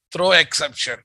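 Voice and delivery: ordinary talk, nothing marked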